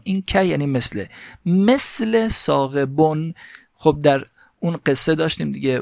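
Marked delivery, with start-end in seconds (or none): 1.5-3.3 teacher slowly voicing the Arabic example for students; slow recitation. Shown as a demonstration. Teacher to students, reading the Arabic text slowly. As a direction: read مثل ثاقبون slowly, each syllable drawn out.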